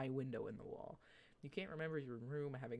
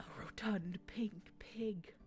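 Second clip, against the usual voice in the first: slow breathy whisper reveal; 5 semitones higher, slower than usual. Acted. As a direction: hush it like a secret, slowly. Dramatic reveal of the pig.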